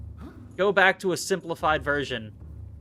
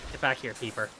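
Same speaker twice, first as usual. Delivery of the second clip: ordinary delivery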